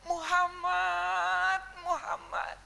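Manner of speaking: high, soft, drawn-out name; tender cooing. Acted. Mother hugging her newborn, tender high cooing. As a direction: croon the name slowly to a baby. Mother coos to hugged baby.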